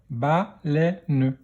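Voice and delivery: "Baleine" split into ba, lè, ne; "Baleine" in three separate beats; syllable by syllable. Shown as a demonstration. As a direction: sound out "Baleine" with a final ne. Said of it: "dans quelle syllabe".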